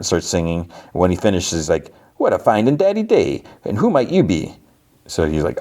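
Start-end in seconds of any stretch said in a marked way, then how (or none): 2.2-4.6 high, swooping cartoon showman voice; hammed-up high cartoon host; Mister Ring-a-Ding. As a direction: greet them as a singsong cartoon character. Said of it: "Mister Ring-a-Ding pops out from behind the curtain".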